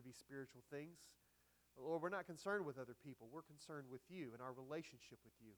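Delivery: soft, hushed prayer voice. Acted. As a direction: pray quietly and reverently before the sermon. Pastor's closing prayer.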